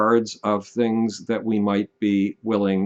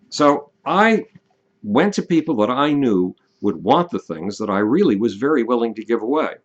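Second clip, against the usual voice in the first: normal talking voice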